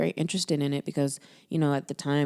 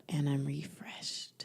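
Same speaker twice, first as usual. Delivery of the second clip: slow, breathy whispered sign-off; mock whisper; 9 dB quieter, much slower than usual. Parodying the app's ending for a friend, whispered. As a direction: whisper it like the recording's last words. Mocking meditation app ending.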